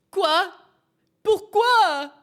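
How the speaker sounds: high, loud squawk of disbelief; baffled outcry. Real, not acted. Player stunned by the reveal, crying out in French.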